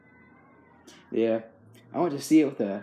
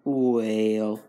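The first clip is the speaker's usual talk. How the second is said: grandpa voice; 2 semitones lower than usual. Shown as a demonstration. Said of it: "You sound like my grandpa".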